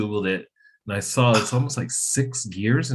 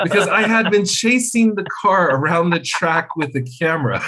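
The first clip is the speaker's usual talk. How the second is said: laughing; 9 semitones higher, 6 dB louder than usual. Real, not acted. Laughing about chasing the car.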